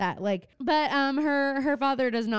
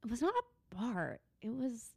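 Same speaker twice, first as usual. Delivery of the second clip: much softer, breathy, airy correction; imitating Arya; 10 dB quieter than usual. Imitating Arya primly correcting her friend. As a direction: mimic her prim little correction. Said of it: "But the way she says it".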